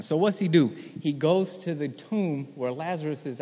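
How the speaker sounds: high, loud, rising preacher's fervour; preaching at full force. Acted. Preacher urging his congregation that this is real, fired up. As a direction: preach it out, pushing toward the congregation.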